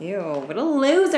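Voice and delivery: slow, drawn-out disgusted sneer. Real, not acted. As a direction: drag it out in disgust. Mocking disgust at the guy.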